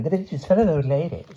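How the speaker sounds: a touch of icy dismissal; cool aristocratic voice; cold posh girl. A narrator's reading. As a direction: brush her off. Girl continues coldly.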